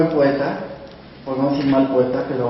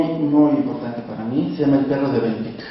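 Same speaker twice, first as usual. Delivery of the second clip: ordinary talk, nothing marked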